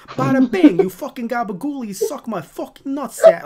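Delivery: loud, high New York tough-guy rant; Grumble. Retold or imitated. Grumble insulting actors on set, loud New York accent. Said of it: "He has this New York accent".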